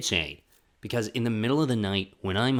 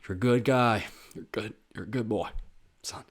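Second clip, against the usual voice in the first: imitating a dad choking up between sighs; tearful dad. Dad to his son, mock choked-up praise. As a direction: pretend to fight back sentimental tears. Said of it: "he cried in sentimental dad moments".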